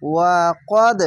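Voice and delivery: ওয়া কদ with the final দ bounced out hard; qalqala bounce. Shown as a demonstration. Teacher showing qalqala on ওয়া কদ with a jolt. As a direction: hit the দ of কদ with a jolt. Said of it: "কলকলা ধাক্কা মেরে হবে".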